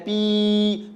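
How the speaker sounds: 'पी' held on a long level ee; long sustained ee in 'पी'; drawn-out vowel. Shown as a demonstration. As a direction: stretch the vowel of 'पी' right out. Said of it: "sound को हम खींचते हैं".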